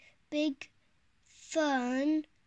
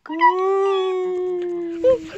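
loud shout; 5 semitones higher, 16 dB louder than usual. Pretend. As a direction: shout goodnight high and long. Child shouts goodnight on request.

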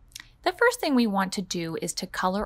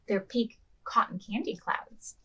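usual speaking voice

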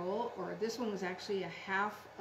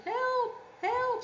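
high, louder storybook scream; 13 semitones higher, 5 dB louder than usual. A narrator's reading. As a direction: cry out for help, hammed up. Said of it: "screamed Dad".

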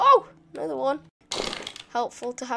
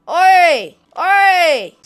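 loud, higher challenge holler; calling out; 4 semitones higher, 12 dB louder than usual. Pretend. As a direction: holler at an enemy across the way. Calls out to game enemy.

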